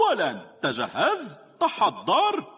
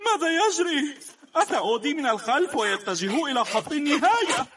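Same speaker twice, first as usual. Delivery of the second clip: usual speaking voice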